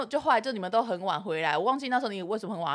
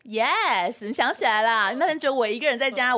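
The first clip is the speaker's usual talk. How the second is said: ordinary talk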